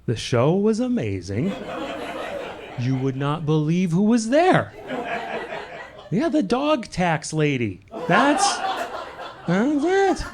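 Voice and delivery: lightly voiced excited friend telling brunch news, rising high at the end; a hint of breathless brunch gossip; imitating a brunch gossiper. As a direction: gush about last night's show. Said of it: "been like".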